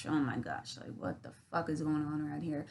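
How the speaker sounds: low, hushed grumble; sullen muttering. Retold or imitated. Sulking in the corner at a party, sullen undertone. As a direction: complain under the breath.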